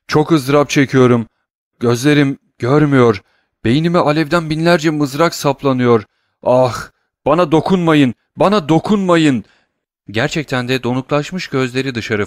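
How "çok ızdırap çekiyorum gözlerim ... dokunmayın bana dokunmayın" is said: loud, higher anguished pleading; cries of agony. Acted. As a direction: beg in agony not to be touched. Servant in agony. (nöbet geçiren uşak, acı içinde yüksek sesle yalvarıyor)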